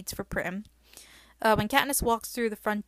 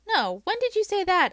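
high, loud demand; 10 semitones higher, 7 dB louder than usual. A narrator's reading. Katniss demanding an answer from her sister, high and loud. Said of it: "I demand".

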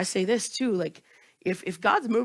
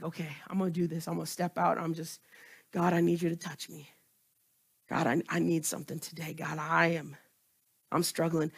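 quiet prayer; 6 semitones lower, 8 dB quieter, faster than usual. Pretend. Voiced worshipper praying for help.

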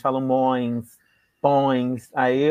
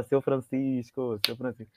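slow, softer mock call; soft callback; 4 semitones lower, 7 dB quieter, slower than usual. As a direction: murmur the name as an inside joke. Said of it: "a gente fala".

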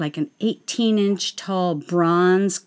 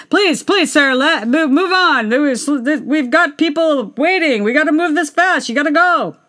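higher, louder urgent pleading; pleading handlers; 7 semitones higher, 3 dB louder than usual. Retold or imitated. Handlers pleading with [name] to move on, urgent. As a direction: beg him to move along fast. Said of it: "the handlers were, like, pleading him".

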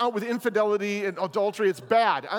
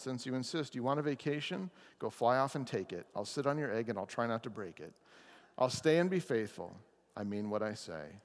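lower, softer, steady elephant voice; a calm, dutiful giant giving his word; elephant promising; 7 semitones lower, 8 dB quieter than usual. Acted. Elephant agrees to sit on the egg, lower and softer.

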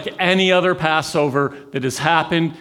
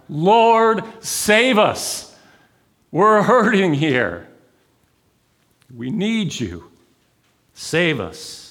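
desperate plea; 5 semitones higher, slower than usual. Retold or imitated. The hurting crowd begging the Lord, desperate.

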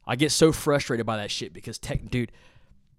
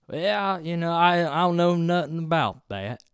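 higher, put-on Southern drawl imitation; mock Southern drawl; 7 semitones higher than usual. Mocking a hometown guy's fake Southern drawl. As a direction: mimic a local faking a country accent. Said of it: "slow Southern drawl".

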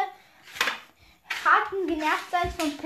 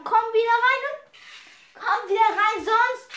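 higher, louder demand; urgent order; 6 semitones higher, 6 dB louder than usual. Acted. Telling Squeak to come back in, urgent raised order.

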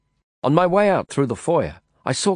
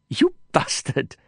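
hissed through gritted teeth; 2 semitones higher, slower than usual. A narrator's reading. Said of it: "she hissed, laughing through gritted teeth".

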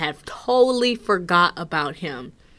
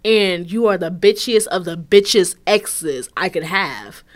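usual speaking voice

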